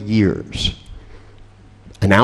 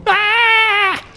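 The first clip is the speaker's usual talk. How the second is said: mock panic cry. [name] being called up on stage by Richard, with a very high panicked yelp. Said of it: "You go".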